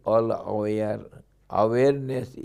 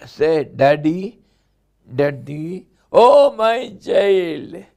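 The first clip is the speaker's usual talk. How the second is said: put-on loud, higher baby-coaxing voice; coaxing parent; 6 semitones higher, 10 dB louder, slower than usual. Parent urging the baby to say Daddy, coaxing.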